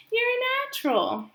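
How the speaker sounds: very high goose voice praising; proud old goose. Acted. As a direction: tell him proudly like a fond old goose. Loudwing praises gosling.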